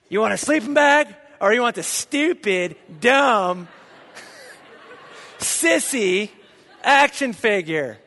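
put-on dad snapping his ultimatum at the boys; mock angry dad. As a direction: scold the kids like a fed-up dad.

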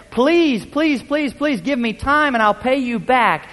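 high, pleading please, please, please, slave begging on knees; a debtor on his knees begs the owner, pleading high. Retold or imitated. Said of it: "fell on his knees and begged".

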